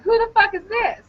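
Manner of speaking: high, indignant phone demand; mock indignant challenge. Caller demanding to know who answered, mock outraged. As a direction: replay the outraged question in a high voice.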